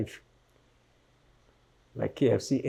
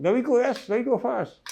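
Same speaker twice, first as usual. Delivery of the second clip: higher, louder mock urgent call; retold urgent shout; 9 semitones higher, 4 dB louder than usual. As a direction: hurry them along, raised and high. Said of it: "they say".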